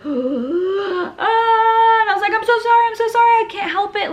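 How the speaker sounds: high, loud, panicked cry of sorry; panicked apology. Retold or imitated. Mother in labor apologizing to the anesthetist, panicked and loud. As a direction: blurt it out in a rush, helpless and scared. Contraction mid-epidural, apologizing.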